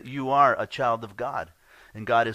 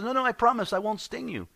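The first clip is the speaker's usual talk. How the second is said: much higher pleading scorpion voice; promising scorpion; 12 semitones higher, 3 dB louder than usual. Acted. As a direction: sweet-talk the frog into it. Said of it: "He goes".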